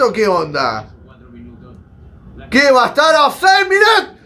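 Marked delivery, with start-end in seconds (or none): 2.5-4.1 very high, loud, ending in a scream; furious yelling. Real, not acted. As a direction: rage at the replay. Outraged at offside replay. (gritos furiosos)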